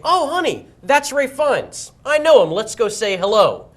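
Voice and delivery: high, drawn-out casual boast to a date; showing off. Pretend. Telling his girl he knows the star, showing off. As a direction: pretend to spot a famous friend.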